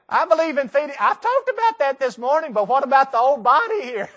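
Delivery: high, loud comic pleading; mock protest. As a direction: plead for the old body, hammed-up. Joking protest about going without food.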